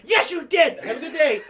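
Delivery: high, loud retort with a send-off; bus driver shouting. Retold or imitated. Bus driver arguing while dropping kids off, shouting. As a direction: holler back at the kid mid-argument.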